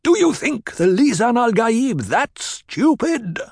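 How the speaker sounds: Paul rebuking the crowd, angry; angry outburst. A narrator's reading. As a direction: hurl it at them in anger. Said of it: "Paul hurled his voice at them in anger".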